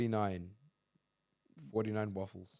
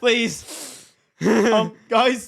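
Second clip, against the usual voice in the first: much higher and louder plea; loud high pleading; 11 semitones higher, 13 dB louder, much slower than usual. Acted. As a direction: beg the audience, loud and high. Begging co-host to end episode.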